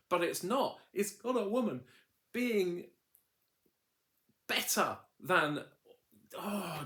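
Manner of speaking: high, emphatic, carried-away enthusiasm; excited gushing. Real, not acted. Reviewer urging viewers to watch the show, excited. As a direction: plead the show's case with rising excitement.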